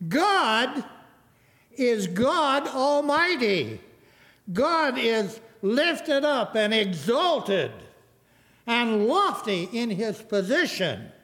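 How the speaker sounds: slow, ringing declaration with long pauses; emphatic preaching. Real, not acted. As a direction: preach it with weight, phrase by phrase. Preaches at full force.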